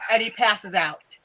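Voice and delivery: ordinary delivery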